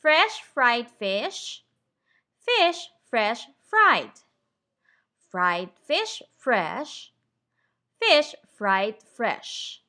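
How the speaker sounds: fresh fried fish spoken very slowly, every F clear; slow tongue twister. Shown as a demonstration. Teacher models the F tongue twister slowly for the class. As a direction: take it slowly, word by word, crisp F sounds. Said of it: "So we say".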